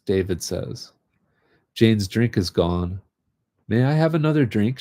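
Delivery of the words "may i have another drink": quick, higher, politely asking; sweetly polite, a little fast; polite request. A narrator's reading. Jane asks David's father for another drink, politely. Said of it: "she asks politely".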